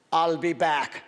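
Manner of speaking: a light Austrian action-hero line; a hint of the Terminator catchphrase; Arnold Schwarzenegger impression. Ventriloquist quizzing the bird, lightly voiced action hero. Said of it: "Who said this?".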